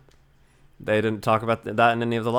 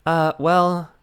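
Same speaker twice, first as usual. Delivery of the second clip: ordinary talk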